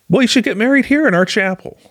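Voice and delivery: high, quick, imitated enthusiasm; mock eager suggestion. Camp director offers couple the chapel, high eager voice. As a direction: pitch it way up and offer it keenly.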